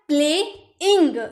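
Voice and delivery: "प्लेइंग" drawn out slowly in two beats, प्ले... इंग; split into syllables. Shown as a demonstration. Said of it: "यानी कि ये दो syllable".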